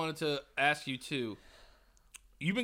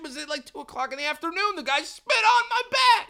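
high, loud disbelief; outraged; 11 semitones higher, 6 dB louder than usual. Real, not acted. Telling a friend about the spitter, outraged and high. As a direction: cry out how outrageous it was.

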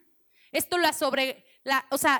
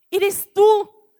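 joyful recognition; 6 semitones higher, 8 dB louder than usual. Acted. Mary recognises Jesus's voice.

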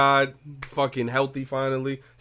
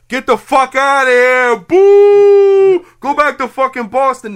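hollering like jeering Red Sox haters, much higher and louder; mimicking booing fans; 10 semitones higher, 13 dB louder, slower than usual. Imitating Yankee fans jeering Red Sox batters, loud mock yelling. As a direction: boo them like a hostile stadium crowd. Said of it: "Every time the motherfuckers come up the bat".

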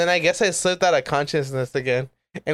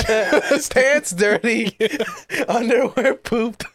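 laughing; 7 semitones higher, much slower than usual. Real, not acted. Laughing through joke list.

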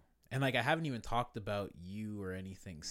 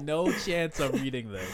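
loud, drawn-out refusal through laughter; laughing refusal; 5 semitones higher, 7 dB louder, slower than usual. Retold or imitated. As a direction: retell it cracking up, slow and emphatic. Retells own refusal, laughing.

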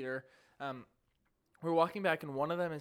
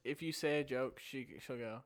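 ordinary delivery

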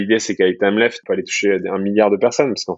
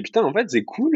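surpris; 11 semitones higher, 4 dB quieter than usual. Retold or imitated. Retells his own surprised thought.

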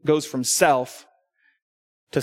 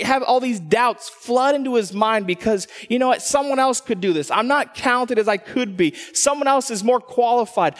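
higher, pressing sermon voice; full-force preaching; 7 semitones higher than usual. Acted. Pastor pressing the congregation, higher preaching voice. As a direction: preach it out to the room.

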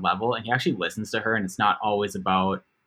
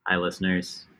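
low-pitched greeting; deeper voice; 3 semitones lower, 4 dB quieter than usual. Real, not acted. Segment host greeting the podcast listeners, deeper voice. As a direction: drop the voice a little to greet. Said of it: "Why do you get so much deeper".

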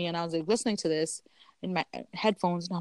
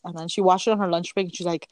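ordinary talk, nothing marked

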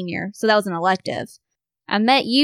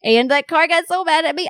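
ordinary delivery

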